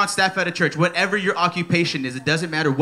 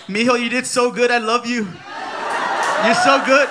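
proud mama; 5 semitones higher than usual. Retold or imitated. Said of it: "my mom just texted me".

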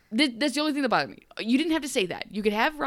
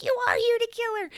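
mock Zelina accusation; faster than usual. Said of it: "she's like".

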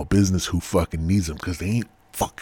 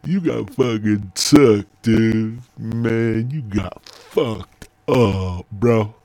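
high, louder mocking bro voice, drawn out; teasing friends; 6 semitones higher, 8 dB louder, much slower than usual. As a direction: taunt him like a gloating buddy. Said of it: "They're like".

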